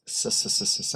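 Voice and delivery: sharp hissing s sounds; unvoiced s, s, s hissing; hissed S's. Shown as a demonstration. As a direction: hiss out a string of S's. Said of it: "I get a bunch of S's".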